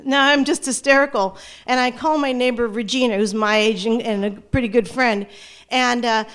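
ordinary delivery

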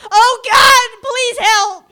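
desperate plea. Retold or imitated. Woman's jail voicemail to her ex, frantic high plea. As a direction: beg for rescue over the phone. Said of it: "on my voicemail it says".

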